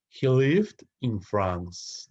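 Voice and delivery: very slow, deliberate answer; slow teacher model. Shown as a demonstration. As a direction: say it slowly so students can copy. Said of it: "teacher models answer slowly".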